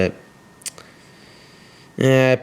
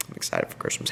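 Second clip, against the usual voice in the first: whispered aside; 10 dB quieter, much faster than usual. Real, not acted. Aside after angry rant.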